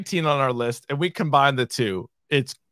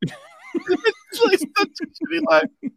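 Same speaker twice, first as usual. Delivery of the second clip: high laughing talk; slower than usual. Real, not acted. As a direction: speak through laughter. Laughing about the line.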